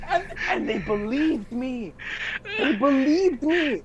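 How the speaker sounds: high, slow, laughing disbelief. Real, not acted. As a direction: laugh through the words.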